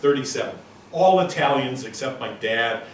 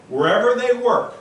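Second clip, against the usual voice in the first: higher and louder on they; emphatic; 9 semitones higher, 7 dB louder than usual. Real, not acted. Speaker stressing his point to the audience, raised and loud. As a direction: punch wherever they work.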